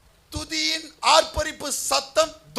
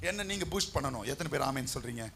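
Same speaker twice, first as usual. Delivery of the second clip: ordinary talk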